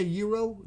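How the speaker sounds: "euro" begun with a y sound and ee vowel; gyro as YEE-roh. Shown as a demonstration. Challenging C with the rival version, "euro" as YEE-roh. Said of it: "Would you say euro?".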